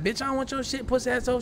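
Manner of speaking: cussing out the store clerk; retold cussing out. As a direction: snap at her like you're insulted. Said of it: "I'm like".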